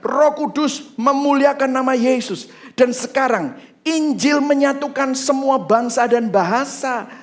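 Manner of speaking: high-pitched, emphatic proclamation; full-force preaching. Real, not acted. Preacher proclaims the gospel uniting all nations, full-force preaching. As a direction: proclaim it to the whole church.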